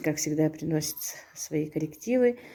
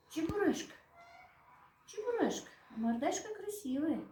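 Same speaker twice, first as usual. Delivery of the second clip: kitten call, much higher and soft; high sweet calling; 11 semitones higher, 10 dB quieter than usual. Real, not acted. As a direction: coo the name up high.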